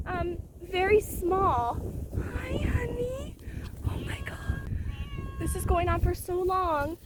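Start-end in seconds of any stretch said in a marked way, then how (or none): 2.1-4.7 hushed cooing. Real, not acted. Whispering to the cat.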